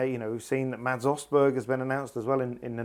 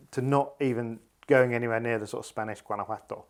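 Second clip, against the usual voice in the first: usual speaking voice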